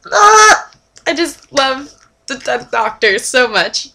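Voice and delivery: a high, loud squeal then gushing slowly; fangirl squeal. Real, not acted. Fan gushing over the Tenth Doctor, squealing. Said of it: "fangirl squeal over Tenth Doctor".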